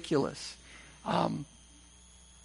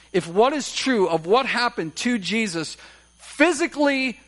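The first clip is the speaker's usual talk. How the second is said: a touch louder and higher, driving each word home; impassioned preaching; 4 semitones higher, 4 dB louder than usual. Acted. Pastor urges the congregation toward response, building emphatic preaching. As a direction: preach it, stacking each point with rising force.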